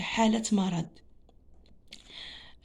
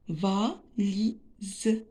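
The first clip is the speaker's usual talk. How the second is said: valise slowly as va-li-se, with gaps; teacher breaking valise into syllables; split into syllables. Shown as a demonstration. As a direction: sound out valise in three separate pieces. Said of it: "on va découper les mots en syllabes".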